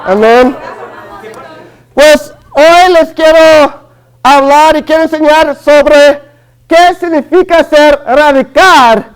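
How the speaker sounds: ordinary talk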